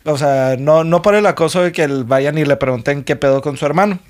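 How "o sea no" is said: normal talking voice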